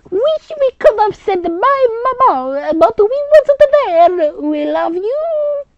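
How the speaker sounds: high, slow, loud hammed-up grief; mock mourning girl. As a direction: wail it like an overdone goodbye. Girls mourn at grandmother's grave.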